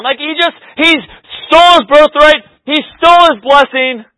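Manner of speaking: loud, high, building sermon voice; fired-up preaching. Acted. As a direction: drive the story home, loud and high. Preaching at full force.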